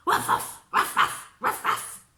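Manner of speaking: loud breathy ruffs; dog barking. Acted. Frightened dog barking at the cat, loud ruffs. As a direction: bark like a little dog.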